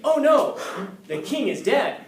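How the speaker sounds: hammed-up cry of alarm; panicked messenger. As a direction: burst in with bad news. Messenger rushes in with news.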